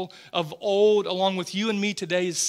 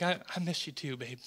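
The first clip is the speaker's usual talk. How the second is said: tender reply; 3 semitones lower, 9 dB quieter, faster than usual. Retold or imitated. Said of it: "'cause I'm like".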